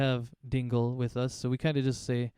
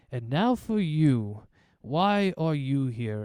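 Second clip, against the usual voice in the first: slow, louder stern ex-warrior mayor; 2 semitones higher, 6 dB louder, much slower than usual. Acted. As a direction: draw it out slowly, demanding an answer. Mayor Bragan turns to party.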